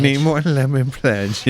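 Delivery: a light mock maid voice, slow; Consuela impression. Friend mimics a maid asking for supplies, slow lightly voiced. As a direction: drawl it out like the housekeeper. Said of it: "Everybody needs a Consuela".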